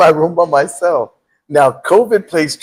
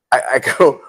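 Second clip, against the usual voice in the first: ordinary talk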